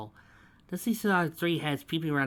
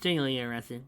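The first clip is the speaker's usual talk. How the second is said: usual speaking voice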